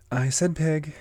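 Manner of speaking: high-pitched insistent reply; firm Alice. Acted. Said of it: "replied Alice".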